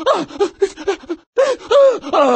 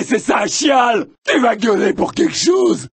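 normal talking voice

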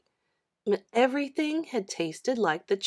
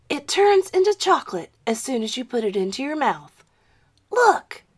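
put-on eager boy; 4 semitones higher, 5 dB louder than usual. John shows off the glove.

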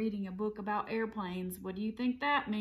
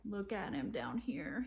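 plain reading voice

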